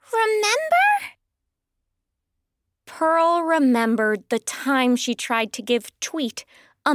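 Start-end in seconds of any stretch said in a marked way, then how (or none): 0.1-1.1 very high, squawky question; pointed little firebird. Acted. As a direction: squeak out a pointed reminder. Firebird pointedly reminds Pearl.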